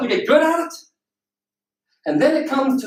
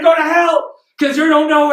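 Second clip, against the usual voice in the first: loud, higher mock retort; yelling back; 4 semitones higher, 5 dB louder than usual. Lottery winner yelling back at people upstairs, defiant. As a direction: shout it back in giddy defiance. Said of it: "And you're yelling".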